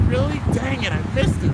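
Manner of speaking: put-on a hyper kid who just missed his friend; frustrated kid voice. Voicing Drum missing Louie, high and frustrated.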